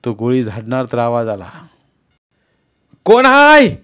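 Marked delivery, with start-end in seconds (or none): 3.0-3.8 loud, high call from off in the dark; far-off villager call; calling out from afar. Acted. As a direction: shout into the night to find who is there. Voice calling from a distance.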